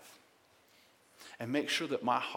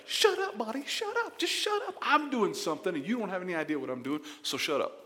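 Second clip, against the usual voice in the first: much higher, louder mock scolding; God saying shut up; 6 semitones higher, 3 dB louder than usual. Pastor voices God telling him to shut up, high mock scold. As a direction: bark it like an exasperated friend cutting you off. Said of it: "he's like".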